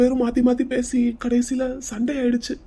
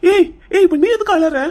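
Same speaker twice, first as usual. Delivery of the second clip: raised voice, pitched well up; agitated outburst; 8 semitones higher, 7 dB louder than usual. Acted. Man turning on his worried companion over crying, agitated and loud. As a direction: cut in loudly, alarmed at the accusation. Startled, demands why she cries.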